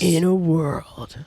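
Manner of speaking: slow, dramatic trailer announcer; movie trailer voice. Pretend. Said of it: "that's, like, your trailer voice?".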